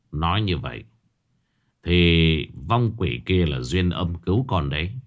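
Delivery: stepfather gently asking his daughter about the ghost; grave stepfather. Acted. Stepfather Hải speaks.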